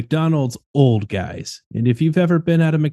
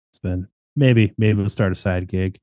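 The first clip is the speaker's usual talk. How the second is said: ordinary delivery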